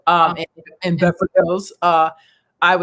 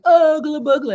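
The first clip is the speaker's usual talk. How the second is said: mock scream; 9 semitones higher than usual. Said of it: "I was screaming".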